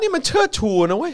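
lightly voiced offended official; mock official. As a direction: protest as the official.

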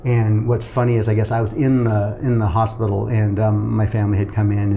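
ordinary talk

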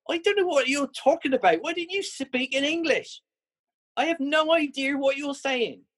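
a very high, giggly, falsetto-like protest; half laughing, playing innocent, high up in the voice; semi-laughing. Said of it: "semi-laughing".

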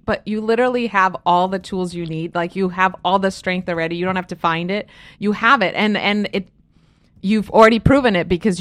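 soft, low, gentle encouragement; reassuring. Real, not acted. Author to struggling sole parent, reassuring. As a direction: quietly assure someone of their strength.

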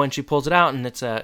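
normal talking voice

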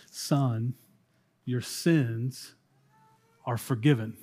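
quiet, hushed words leaning close; soft lean-in. Acted. Jesus leaning close to the paralyzed man, hushed. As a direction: lean in and say it softly. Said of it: "he leans in and bends down".

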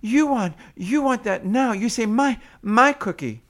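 high, loud, repetitive short phrases; toddlerese. Shown as a demonstration. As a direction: echo the baby's wanting back to them. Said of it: "pointing to the cookie and going".

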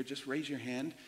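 ordinary delivery

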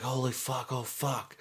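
high, hushed swearing in alarm; mock panic. Crash driver realizing he's in trouble, mock panic.

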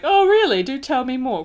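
loud, high mock-fascinated imitation; sarcastic jerk. The Marquis to Richard about the train, sarcastically. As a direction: swoop up high in fake interest. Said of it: "'cause he's a jerk".

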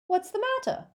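very high, dim thug voice; querulous henchman pulling away; irritated henchman. Acted. Crabbe snaps back in thug voice.

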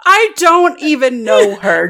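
loud, high, drawn-out protest; indignant denial. Acted. Lou denies having a crush, indignant and loud. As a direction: protest too much, slow and loud.

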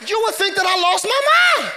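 high-pitched preacher's shout; emphatic exclamation. Real, not acted. Preacher imagining church's reaction to him, high exclamation. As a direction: cry it out high, incredulous.

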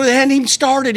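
indignant, the fight just began; outraged disbelief. Retold or imitated. Missed fight, he protests to friend in high loud outrage.

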